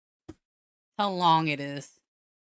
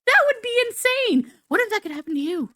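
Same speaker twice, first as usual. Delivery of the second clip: mock shock. Silly toilet disappearance musing.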